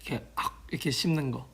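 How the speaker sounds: hushed asmr ad whisper. Pretend. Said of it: "저 딱 등장할까요?".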